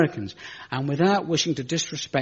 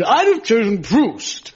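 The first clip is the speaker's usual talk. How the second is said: high, slow highbrow pose; hammed-up pompous critic; Mark Lawson; 8 semitones higher, 5 dB louder, slower than usual. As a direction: sound lofty and literary. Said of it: "he would say".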